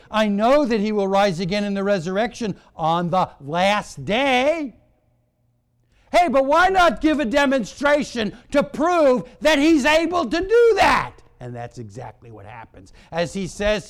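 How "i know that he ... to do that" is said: raised, ringing sermon delivery; high, loud challenge to the congregation; full-force preaching. Real, not acted. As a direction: preach loudly, pitch climbing to a shout.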